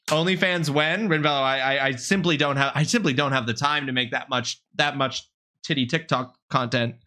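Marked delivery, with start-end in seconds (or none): none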